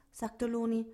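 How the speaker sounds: ordinary delivery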